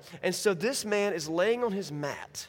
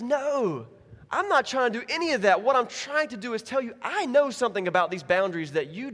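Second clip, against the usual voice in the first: emphatic correction; 5 semitones higher, 4 dB louder than usual. Acted. Voices God firmly correcting the objector.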